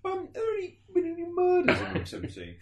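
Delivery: high, timid guest asking; nervous hotel guest. Retold or imitated. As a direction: squeak out the question.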